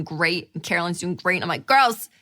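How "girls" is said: louder, higher, giddy urging; rally the girls with happy excitement; eager and bubbly, pitched up; acted happiness. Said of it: "when I get so happy".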